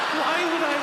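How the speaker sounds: high, loud mock-horrified cry; panicked self-questioning. Comic appalled by thought of spitting, loud mock panic.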